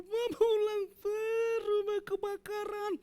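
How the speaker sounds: put-on child crying on a video call that the house is burning; panicked child crying. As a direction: shout it like a scared child on the phone. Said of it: "Dia nangis video call".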